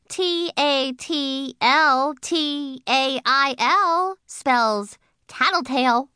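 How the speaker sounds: slow, defiant spelling-out; sassy comeback. Acted. Junie B firing back at May, slow and sassy. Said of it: "I said back".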